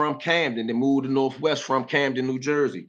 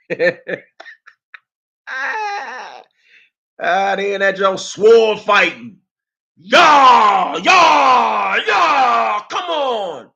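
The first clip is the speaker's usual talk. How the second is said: loud high shouts of yeah; hyped yelling; 6 semitones higher, 8 dB louder, much slower than usual. Retold or imitated. Cheering on [name] and [name] fighting in the room. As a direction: cheer them on loudly.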